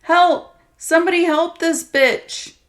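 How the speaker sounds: loud, high sarcastic yell for help; the abuser mockingly yells for help over her; mocking shout. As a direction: shout to mock her pleas. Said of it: "then shouted".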